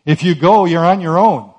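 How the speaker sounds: loud, firm no from the Lord; stern refusal. Retold or imitated. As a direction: forbid it sternly and loudly. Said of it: "the Lord says".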